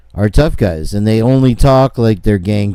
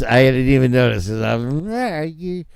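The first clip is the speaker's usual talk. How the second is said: ordinary delivery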